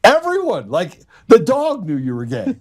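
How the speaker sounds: high-pitched, punchy comic exaggeration; retold exasperated disbelief. Boss telling coworker everybody already knew, high comic exasperation. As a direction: pitch it way up on the obvious truth.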